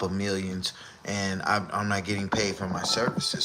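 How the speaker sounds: imitating Scar. Keeps doing Scar's excuse.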